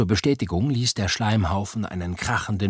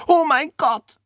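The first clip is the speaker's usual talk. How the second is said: very high-pitched cry of shock; startled scream; 3 dB louder than usual. A narrator's reading. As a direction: shriek it in sudden alarm. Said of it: "schrie Oda auf". (erschrocken aufschreien)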